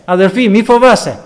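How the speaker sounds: rally them boldly, don't be afraid; loud, fervent call to take courage; preaching. Acted. Encouraging believers not to fear.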